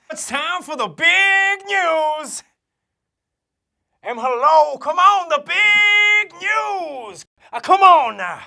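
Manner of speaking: stretched, slowed announcer shout; excited shout. Acted. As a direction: build up the big news. Forcing the big news, shouting.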